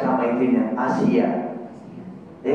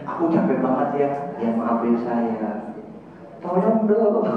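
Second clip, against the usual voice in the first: tired gentle plea; 6 semitones lower than usual. Retold or imitated. Husband gently asking tired wife. (suami minta tolong dengan lembut dan lelah)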